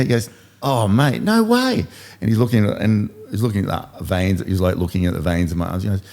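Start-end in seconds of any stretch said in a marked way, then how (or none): 0.6-1.9 put-on high, boyish kid's voice; little kid voice. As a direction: squeak it up like a small boy on a bike. Kid disbelieves his age.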